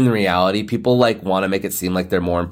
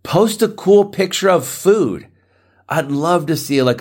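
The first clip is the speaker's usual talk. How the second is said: high playful voice; 9 semitones higher, 3 dB louder than usual. Pretend. Playful demand for food pics.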